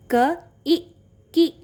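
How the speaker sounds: क, ई, की in brief separate beats; short vowel blend. Shown as a demonstration. Teacher recapping the blend for children, short ई and की. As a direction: end की quickly on a short vowel. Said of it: "छोटी ई की मात्रा का sound होता है छोटा".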